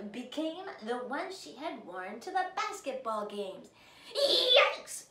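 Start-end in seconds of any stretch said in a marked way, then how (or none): 4.1-5.0 high loud shriek with a laugh; scream. A narrator's reading. Stepsisters seeing Ellie's clothes change, loud shriek. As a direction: shriek in shock. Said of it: "screamed the sisters".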